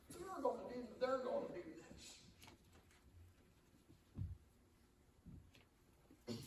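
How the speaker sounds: high, fretful, anxious whine; mock worried fretting. Preacher mimics believers fretting over enemies, high and anxious. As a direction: lampoon the worrier's panic. Mocking our worried talk.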